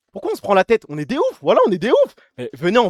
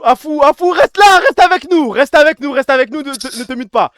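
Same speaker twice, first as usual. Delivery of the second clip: high, loud plea, repeated; urgent calling out; 4 semitones higher, 4 dB louder than usual. Real, not acted. Streamer begging a quarreling guest to stay, urgent calling out. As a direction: call them back urgently, pitched up.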